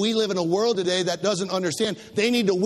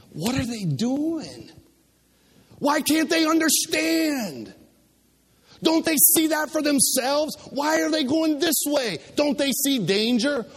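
higher, pleading questions in a strained voice; worried onlooker pleading over lost people, higher; anguished pleading; 6 semitones higher than usual. Acted. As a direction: plead with growing dismay.

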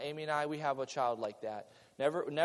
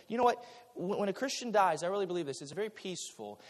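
ordinary talk

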